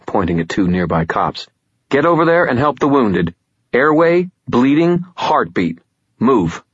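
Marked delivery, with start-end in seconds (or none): 1.9-6.6 calm turning angry. A narrator's reading. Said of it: "snapping orders".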